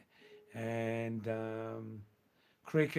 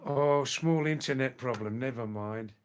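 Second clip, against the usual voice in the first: ordinary delivery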